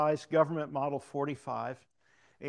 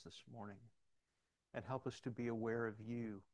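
soft, reverent prayer, sinking quieter; 5 dB quieter than usual. Real, not acted. Leading the congregation to God, hushed prayer. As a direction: talk humbly to God, ever more softly.

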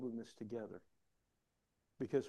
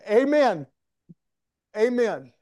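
high, very loud amen; exultant shout; 8 semitones higher, 15 dB louder, slower than usual. Real, not acted. Preacher to congregation after a laugh, exultant shout. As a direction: call it out to the whole church.

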